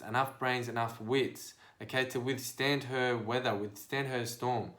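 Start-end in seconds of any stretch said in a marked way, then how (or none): none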